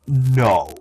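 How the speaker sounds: firm "no" in his own pitch; no with chutzpah. Shown as a demonstration. Showing how to simply tell them, firm no with chutzpah. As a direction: put some nerve behind a simple no. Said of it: "put a little bit of chutzpah behind that no".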